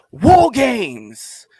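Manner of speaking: loud, high hyped-up announcement; announcer hype. Real, not acted. Host kicks off the War Games topic with a loud, high hype announcement. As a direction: shout it like a big event reveal. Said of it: "let's get into".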